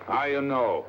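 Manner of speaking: put-on lightly accented Lascar sailor; murderous Lascar. Disguised detective questioning tavern drinkers, lightly voiced Lascar. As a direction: speak in a guarded, put-on Lascar voice. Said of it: "in the character of a murderous Lascar".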